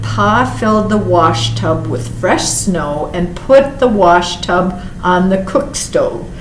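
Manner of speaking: ordinary delivery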